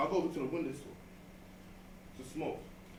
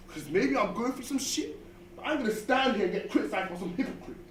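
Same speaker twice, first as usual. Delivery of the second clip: angry; 6 semitones higher than usual. Acted. Angry close to rant.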